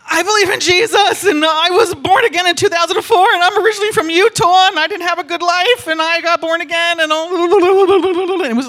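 loud, high breathless blurting; frantic rattling. Retold or imitated. Young guy suddenly pouring out his testimony, frantic rattle. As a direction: rattle it all out, dissolving into babble. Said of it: "a minute of rattle".

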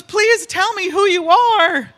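high, loud, stretched-out begging; desperate plea. Retold or imitated. Young guy begging the stranger, desperate plea. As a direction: plead slowly at the top of your voice.

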